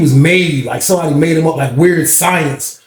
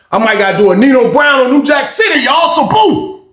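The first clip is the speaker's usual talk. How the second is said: worked-up boast; 6 semitones higher than usual. Retold or imitated. Imagining beating the sergeant.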